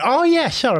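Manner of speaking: high-pitched oops-sorry reply; mock sudden remembering. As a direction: imitate a waiter who just remembered. Owner's acted apology.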